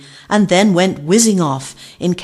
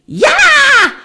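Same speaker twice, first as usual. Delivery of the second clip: very high, loud startled yell; scream; 10 dB louder than usual. A narrator's reading. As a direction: shriek as you hit the water. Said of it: "a loud splash and a scream".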